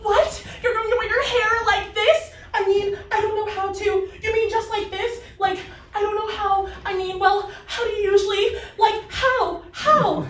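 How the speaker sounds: high, loud, stammering panic; flustered hairdresser panicking over the afro; flustered lady. Retold or imitated. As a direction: play the hairdresser losing it. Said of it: "She's so flustered and turns bright red and is like".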